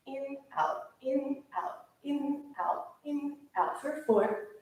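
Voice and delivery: high, evenly spaced in-and-out calls; rhythmic breath cues. Real, not acted. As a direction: count the breaths in a steady, lilting rhythm.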